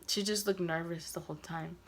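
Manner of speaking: ordinary talk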